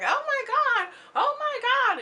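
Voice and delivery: high, loud mock outburst; New York. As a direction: wail it out in a piercing, mocking impression. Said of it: "in her New York voice".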